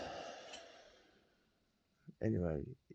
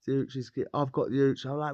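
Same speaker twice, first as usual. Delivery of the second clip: ordinary talk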